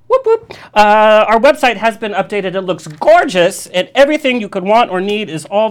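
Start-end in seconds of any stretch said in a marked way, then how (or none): 0.1-0.5 high, excited whoop; whoop whoop cheer. Real, not acted. Host cheering his free app plug, high excited whoop. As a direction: cheer it out like a radio hype call.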